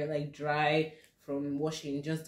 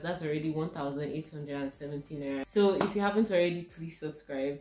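usual speaking voice

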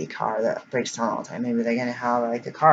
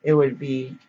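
normal talking voice

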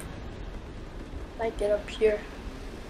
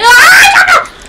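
very high, very loud shriek; terrified scream; 13 dB louder than usual. Real, not acted. Gamer caught by an infected player, shrieking scream. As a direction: scream in sudden panic.

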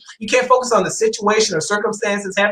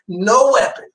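laughing; 4 semitones higher, slower than usual. Real, not acted. Laughing while quoting scripture.

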